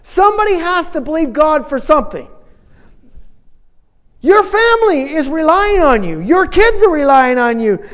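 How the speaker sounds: loud, high, impassioned preacher's call; a pastor rallying the congregation to believe, at full force; fiery preaching. Acted.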